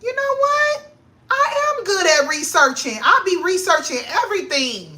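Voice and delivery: high, loud, thrilled self-discovery; excited realization. Real, not acted. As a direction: suddenly realize you're great at it. Excited realization about herself.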